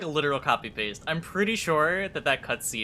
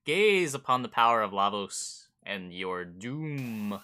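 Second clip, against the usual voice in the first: low, slow, looming threat; sneering panto villain; 5 semitones lower, slower than usual. Acted.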